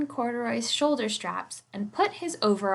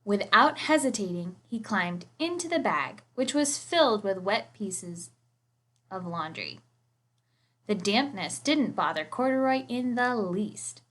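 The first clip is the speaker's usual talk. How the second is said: ordinary delivery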